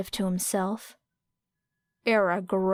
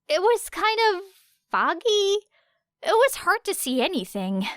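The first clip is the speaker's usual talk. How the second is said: lightly voiced Kaminari; 11 semitones higher than usual. A narrator's reading.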